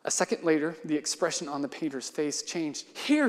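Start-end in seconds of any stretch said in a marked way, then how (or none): 3.0-3.3 alarmed cry. Acted. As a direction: cry out to stop him. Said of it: "he cried".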